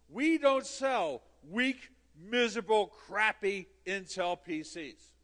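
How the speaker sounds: loud, slow, hammering each scornful word; hammed-up scornful proclamation; indignant refusal. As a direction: declare it emphatically with mock disdain. Indignant refusal retold to Kurt.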